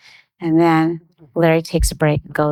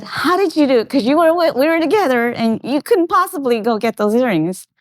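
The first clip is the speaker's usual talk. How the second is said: high, tumbling retold question; amazed; 7 semitones higher than usual. Wife asking husband how he got the earrings, amazed. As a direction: re-enact baffled delight.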